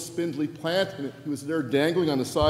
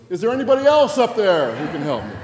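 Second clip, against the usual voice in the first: loud, raised plea upward; calling out for help; 5 semitones higher, 11 dB louder than usual. Retold or imitated. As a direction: call up loud, hoping someone hears.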